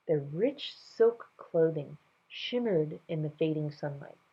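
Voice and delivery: ordinary delivery, nothing marked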